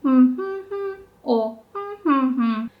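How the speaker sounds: wordless humming of pitch-accent shapes; hummed pitch patterns. Shown as a demonstration. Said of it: "Japanese words always sound like".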